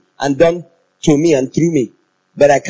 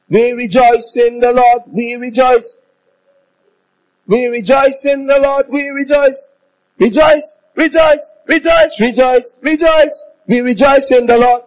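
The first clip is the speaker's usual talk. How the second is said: exultant rejoicing, much higher; demonstrating rejoicing; 9 semitones higher, slower than usual. Shown as a demonstration. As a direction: show them how to rejoice, calling it out. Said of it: "Can you show me your face rejoicing".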